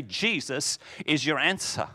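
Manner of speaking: ordinary delivery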